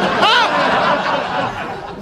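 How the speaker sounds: drawn-out laughing ah. Real, not acted. Comic telling pub drinking story, laughing. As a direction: laugh it out slowly. Drunken session recalled.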